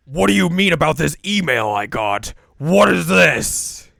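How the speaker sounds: loud accusing demand; confronting. Retold or imitated. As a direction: confront her, loud and sharp. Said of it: "She's like".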